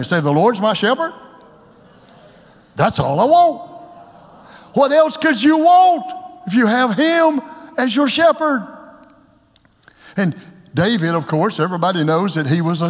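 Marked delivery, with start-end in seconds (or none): 0.2-3.7 call and response. Shown as a demonstration. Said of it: "Say it with me". 4.7-8.7 preaching shout. Real, not acted.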